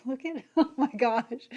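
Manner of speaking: giddy excited. Real, not acted. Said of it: "like, giddy excited".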